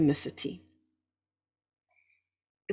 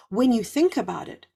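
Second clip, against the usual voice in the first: ordinary delivery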